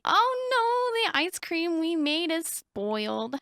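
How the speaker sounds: high, drawn-out "oh no" easing down; playful wail of disappointment; mock dismay. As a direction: lament a small disaster, slowing as you go. Finds the game food spoiled.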